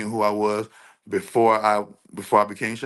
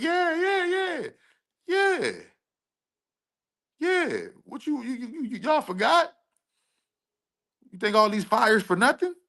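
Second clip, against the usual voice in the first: taunting; 13 semitones higher than usual. Real, not acted. Taunting enemies about disasters.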